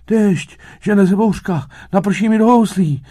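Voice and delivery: high, loud, panicked cricket; wailing. A narrator's reading. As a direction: wail in alarm over the coming rain. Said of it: "bědoval cvrček".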